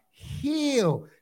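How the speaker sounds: ordinary talk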